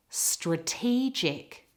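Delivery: slow "Strategic", weight on TEE; stress on middle syllable. Shown as a demonstration. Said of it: "It's in the middle of the word".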